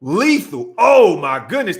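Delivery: loud burst of real amazement; awed exclamation. Real, not acted. Streamer rewatches the lethal run, exclaiming in awe. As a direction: blurt it out loudly, bowled over.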